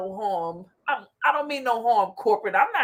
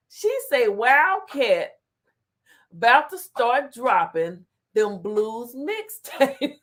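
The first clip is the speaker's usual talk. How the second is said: slow chat reading; slower than usual. Real, not acted. Slowly reading the chat aloud.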